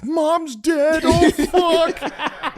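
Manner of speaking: high, panicked yell of a scared sister; panicked high shriek. Retold or imitated. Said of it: "Katie was behind her and was like".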